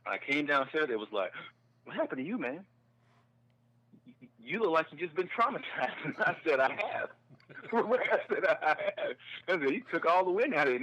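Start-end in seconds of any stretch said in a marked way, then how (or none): 1.8-5.9 high-pitched amused disbelief; retold astonishment. Friends greeting the shaken comic downstairs, astonished. 6.0-10.8 high, loud telling through laughter; laughing. Real, not acted. Comic telling how he answered his friends, laughing.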